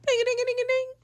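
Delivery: quick, high monotone ring; imitated phone ringtone. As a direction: mimic an insistent phone.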